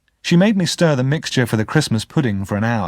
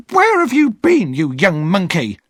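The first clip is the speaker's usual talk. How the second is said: sharp scolding, pitched up high, partly hissed; angry cry; 9 semitones higher, 3 dB louder than usual. Acted. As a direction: scold him like an angry older sister. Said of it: "Missus Joe cried".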